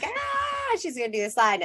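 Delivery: squealed high, then a thrilled rush; excited kid squeal. Retold or imitated. Mother imitating her son's high excited squeal. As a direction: squeal it like an overjoyed little boy. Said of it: "it was like".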